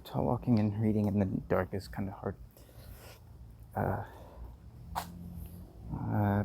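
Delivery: normal talking voice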